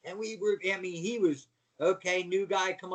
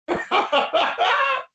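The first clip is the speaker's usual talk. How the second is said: much higher, loud burst of laughter; loud cackling laugh; 14 semitones higher, 12 dB louder than usual. Retold or imitated. Razor Ramon reacting in the ring, loud high cackle. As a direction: cackle like you just got away with it. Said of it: "and he goes".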